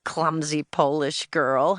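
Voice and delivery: slow, mocking boy's jeer; sneering schoolyard name-calling; snickering taunt. A narrator's reading. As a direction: draw the insult out at normal volume, mockingly. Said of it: "A big boy behind me snickered".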